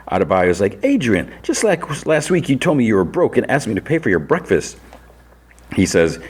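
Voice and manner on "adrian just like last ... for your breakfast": high-pitched imitation of an aggrieved friend; retold complaint, pitched up all through; mock indignation. Friend confronting Adrian about claiming to be broke, indignant imitation.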